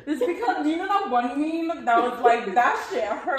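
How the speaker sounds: high, loud, cracking up toward the quote; laughing explanation. Real, not acted. Explaining the meme to a friend, breaking into laughter. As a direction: explain the joke while starting to laugh.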